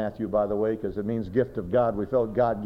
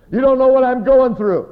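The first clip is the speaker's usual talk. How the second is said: high, loud mock complaint; hammed-up anguished outburst; distraught, imitated; 13 dB louder than usual. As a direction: wail it out in protest. Acted complaint of a sufferer.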